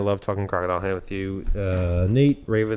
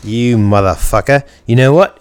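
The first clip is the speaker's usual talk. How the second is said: loud cursing; 12 dB louder than usual. Real, not acted. Host cursing his co-host, loud and exasperated. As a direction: blast the curse out loudly. Swearing at co-host before starting.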